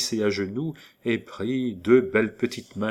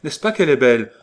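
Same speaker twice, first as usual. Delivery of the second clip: louder, higher burst of recognition; an excited cry of recognition; eager exclamation; 5 semitones higher, 8 dB louder, faster than usual. A narrator's reading. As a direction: blurt it out, delighted. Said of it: "s'écria Hector".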